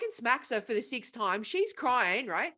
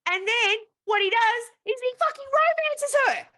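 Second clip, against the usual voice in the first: higher and louder disbelieving outburst; outraged punchline, much higher; indignant outburst; 12 semitones higher, 6 dB louder than usual. Real, not acted. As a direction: blurt out the twist in outrage. Punchline exclamation.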